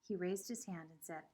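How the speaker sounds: ordinary delivery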